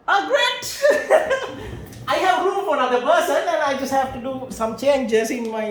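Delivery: mock counselor cracking up; laughing counselor. Lecturer voices a counselor laughing off the proposal, laughing loudly.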